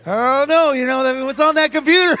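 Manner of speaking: loud, high laughing reply. Real, not acted. Recalling his answer to the officer, laughing loudly. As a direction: crack up while you answer.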